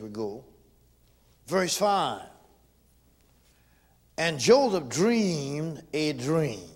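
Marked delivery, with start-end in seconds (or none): 1.5-6.7 loud, slow preaching cadence. Acted. Preacher reading scripture to his congregation, loud and slow. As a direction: preach the verse out to the church. Preaching Genesis at full force.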